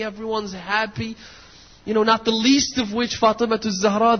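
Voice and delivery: usual speaking voice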